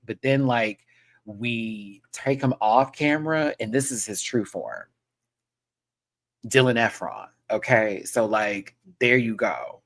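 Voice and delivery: ordinary delivery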